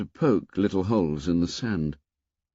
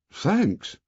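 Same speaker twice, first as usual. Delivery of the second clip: higher, delighted thanks; pleased surprise; 10 semitones higher than usual. A narrator's reading. Ralph thanking the boys for fruit, pleased surprise. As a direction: thank them as if the gift is unexpected. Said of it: "with an accent of pleased surprise".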